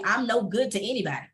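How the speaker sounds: usual speaking voice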